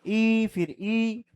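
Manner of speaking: "ई" stretched long and slow, with the lips nearly closed; a slow, soft "ई" demo; drawn-out ee. Shown as a demonstration. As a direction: hold "ई" slowly, mouth almost shut. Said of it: "उच्चारण करोगे ई".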